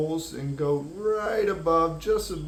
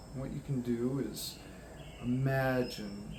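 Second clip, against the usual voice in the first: calm, unhurried guidance with long pauses; guided meditation; 2 semitones lower, 5 dB quieter than usual. Acted. Guiding listeners to imagine grounding light, calm meditation voice. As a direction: lead them gently inward, drawing out key words.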